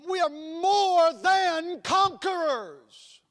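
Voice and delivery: high, loud, slow proclamation; preacher thundering the promise; triumphant shout. Real, not acted. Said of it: "I highlighted these three words".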